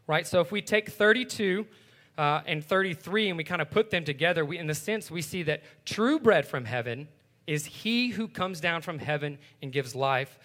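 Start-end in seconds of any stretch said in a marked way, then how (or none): none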